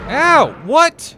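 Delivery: loud pained yelp; startled yelp. Real, not acted. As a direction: yelp as you get hit. Hit by the Leviathan.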